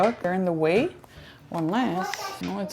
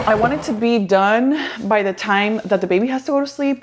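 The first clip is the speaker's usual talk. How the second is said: ordinary delivery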